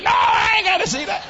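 loud high refusal. Retold or imitated. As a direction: yell back in protest. Grandfather refusing loudly.

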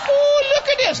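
put-on squealing high baby-talk coo. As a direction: coo way up high like a doting mom. Family cooing over baby.

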